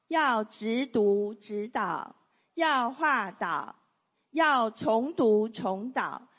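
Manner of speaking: slow, loud, syllable-by-syllable reading of the key points; group recitation. Shown as a demonstration. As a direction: recite each rule slowly for the class to repeat. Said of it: "好，一起来。".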